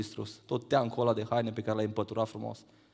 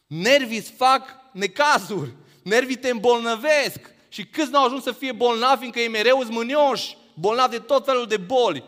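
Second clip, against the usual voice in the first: fiery preaching; 9 semitones higher, 5 dB louder than usual. Real, not acted. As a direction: preach the warning with force. Preaching at full force.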